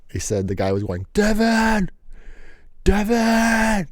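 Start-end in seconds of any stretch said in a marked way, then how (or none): 1.1-3.9 high mock yell of the name; screaming a name. The angry guy yelling after the runaway friend, high mock yell. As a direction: holler it across the street like the angry guy.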